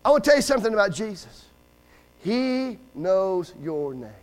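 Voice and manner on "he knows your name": very slow, lower, measured words; solemn assurance. Real, not acted. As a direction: let each word land slowly and low. Preacher's solemn answer to the plea.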